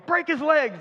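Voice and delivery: high mock yell at the game; playful retold fan yell. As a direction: shout it like a fan at the TV. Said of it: "I actually yelled the other night".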